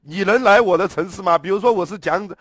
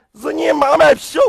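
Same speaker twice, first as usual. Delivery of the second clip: angry shout; 6 semitones higher, 6 dB louder than usual. Real, not acted. Ranting about the neighbour.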